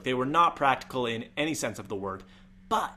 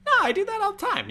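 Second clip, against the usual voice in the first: very high falsetto squeal, louder; exaggerated voice-crack falsetto; intentional squeaky voice; 5 dB louder, faster than usual. Shown as a demonstration. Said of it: "I just like talking like this".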